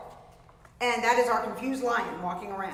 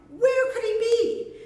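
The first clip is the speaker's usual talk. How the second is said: worried wondering; 11 semitones higher, 4 dB louder, slower than usual. Acted. Worried parents wonder aloud.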